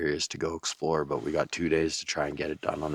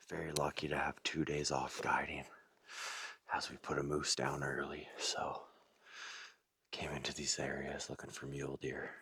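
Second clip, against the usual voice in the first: wildlife watching whisper; 13 dB quieter than usual. Real, not acted. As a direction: keep whispering to stay hidden. Whispered narration while stalking deer.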